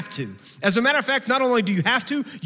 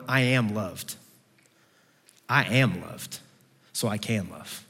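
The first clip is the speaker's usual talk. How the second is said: quiet affirmation; 6 semitones lower, 5 dB quieter than usual. Shown as a demonstration. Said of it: "went through our day tomorrow going".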